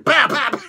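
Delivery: loud, punchy mouth gunshots; mock gunshots. Fan mimicking a sniper writer, loud mouth shots. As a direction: fire two shots with the mouth. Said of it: "I'm a Magic Cates".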